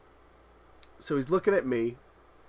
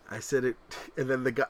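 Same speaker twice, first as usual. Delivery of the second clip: usual speaking voice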